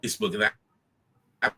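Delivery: choppy, cutting-out call audio; corrupted glitchy audio. Real, not acted. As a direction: voice breaking up over a bad line. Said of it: "your audio is cutting out".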